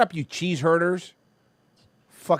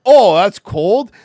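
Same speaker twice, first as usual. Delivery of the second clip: loud, slow mocking scoff; scoffing northerner; 4 semitones higher, 12 dB louder, much slower than usual. Mocking a Northerner unimpressed by Florida cold. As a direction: sneer like a smug northerner. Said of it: "they're like".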